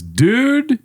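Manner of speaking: high, drawn-out "dude"; imitating a caller's "dude". Comic recounting how a phone call opened, high drawn-out imitation. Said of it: "starts like that with Jesus".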